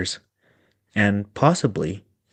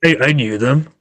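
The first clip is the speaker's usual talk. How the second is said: John showing off; 4 semitones higher, 5 dB louder than usual. Acted. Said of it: "to annoy the others".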